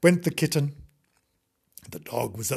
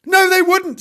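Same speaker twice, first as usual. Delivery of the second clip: high, loud contradiction; exasperated dog; 9 dB louder than usual. Acted. Dog contradicting the frightened kitten, exasperated. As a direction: shoot the worry down loudly.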